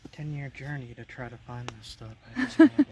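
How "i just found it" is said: thrilled yell, high and loud; excited shout. Real, not acted. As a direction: shout it out in triumph. Finally finds the rock.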